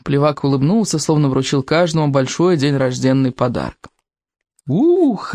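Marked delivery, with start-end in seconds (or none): 4.7-5.3 joyful whoop. A narrator's reading. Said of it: "радостно завопили".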